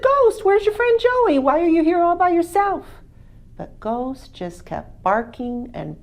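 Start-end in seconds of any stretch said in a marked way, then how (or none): 0.0-2.9 much higher, louder puzzled farmer calling to the dog; Farmer Green's voice. Acted. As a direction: call out to the dog, surprised.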